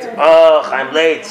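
mildly angry groan on waking late; acted-out grumble, a touch of annoyance; grumpy late riser. Man waking up late grumbles to himself, mildly angry. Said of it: "he gets out of bed angry".

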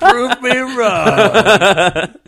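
slow, drawn out through laughter; can barely get the words out for laughing; cracking up while repeating the punchline; laughing echo. Real, not acted. Echoing the joke while laughing.

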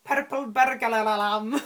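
loud, rolled-R attempt at Scottish; bad Scottish accent. Shown as a demonstration. Host showing off their party piece, bad Scottish accent. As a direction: roll the Rs in a hammy Scottish accent. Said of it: "And in Scottish, that is?".

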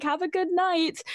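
small, high-pitched sign-off; lowercase voice. Retold or imitated. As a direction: say goodnight like meek lowercase typing. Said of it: "in my lowercase caps".

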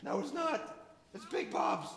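put-on high, hushed panicky kid voice; squeaky worried teen; high flustered teen. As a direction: fret under your breath. Billy Bopper panics softly.

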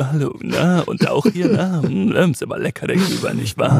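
lightly mocked smooth chat-up voice; a touch of a radio host flirting at a party; smug radio voice.

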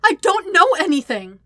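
high, loud, desperate insistence; blubbering. A narrator's reading. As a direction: beg to be believed, near tears. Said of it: "blubbers, on the verge of tears".

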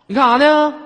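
put-on high, loud angry girlfriend on the phone; shrill girlfriend voice. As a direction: bark it like a suspicious girlfriend. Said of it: "我女朋友给我来个电话". (女朋友质问的尖声)

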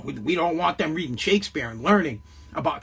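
usual speaking voice

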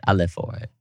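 usual speaking voice

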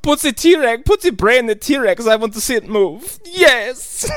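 high, hammed-up German accent; Uwe Boll. Director wanting the T-Rex to move, mock German accent. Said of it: "That's what he sounds like".

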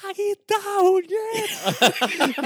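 high, drawn-out panicked yell; retold scream. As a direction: scream it in mock terror, re-enacting the scare. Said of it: "yo empiezo a gritar". (grito de pánico recreado)

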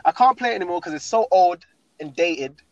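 ordinary delivery

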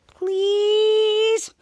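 high, drawn-out please; long high wheedling please; pleading. Acted. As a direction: beg while hugging him. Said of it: "while hugging Schwarz".

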